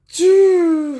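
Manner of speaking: high, loud "choo" of takeoff; imitated zoom. Sonic running off, high imitated zoom noise. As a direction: imitate a cartoon dash with a high whoosh. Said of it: "he goes like".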